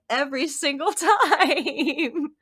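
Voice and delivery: slow, laughing in disbelief; exasperated laughing. Retold or imitated. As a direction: drag it out through laughter. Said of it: "I would just be like".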